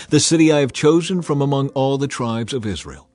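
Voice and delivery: usual speaking voice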